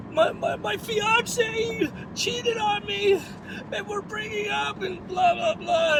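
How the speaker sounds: high, slow tearful wail, imitating her; hammed-up sobbing retelling; mock crying. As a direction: imitate a woman bawling her eyes out. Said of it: "crying her eyes out".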